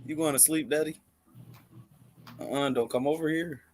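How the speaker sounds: ordinary talk, nothing marked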